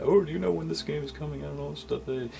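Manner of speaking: high, fast, pushy fan question; mock eager fan. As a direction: parrot the commenters' breathless curiosity. Mimicking eager commenters.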